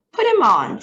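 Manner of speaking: eager president wanting the credit; bossy president. A narrator's reading. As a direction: snap out the order. President gives an order.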